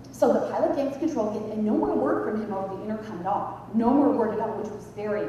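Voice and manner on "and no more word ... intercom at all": ordinary delivery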